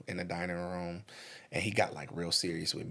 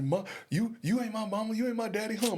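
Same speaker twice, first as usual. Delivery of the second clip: high, fast, mock-defiant child voice; sassy little kid; 13 semitones higher, 4 dB louder, faster than usual. Boy sassing his mom, high and cheeky. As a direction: play a bratty kid talking back, hammed up.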